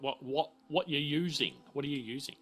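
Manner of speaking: usual speaking voice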